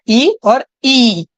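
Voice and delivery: first ई short, second ई stretched long, slowly; short and long vowel. Shown as a demonstration. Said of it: "ये ई है और ये बड़ी ई है".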